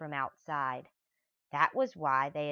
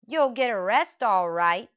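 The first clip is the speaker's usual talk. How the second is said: louder, higher gloating shout; triumphant shout; 6 semitones higher, 11 dB louder than usual. Acted. Mister Dart bursting in on the thieves, shouting. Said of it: "shouted Mister Dart".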